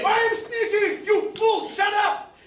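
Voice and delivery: angry shout. Acted. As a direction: yell it back at him in anger. Quarrel between neighbours.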